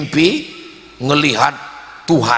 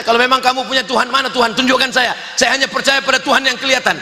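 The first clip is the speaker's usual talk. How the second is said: fast, higher-pitched mock challenger; challenging; 4 semitones higher, faster than usual. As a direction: rattle off the demand for proof. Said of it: "Nantang mereka". (menirukan penantang, cepat dan bernada tinggi)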